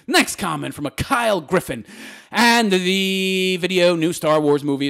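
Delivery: normal talking voice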